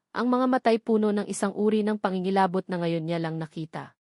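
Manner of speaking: ordinary talk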